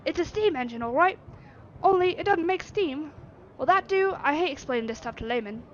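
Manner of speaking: loud, high irritated Yorkshire; snappy Yorkshire artificer. Acted.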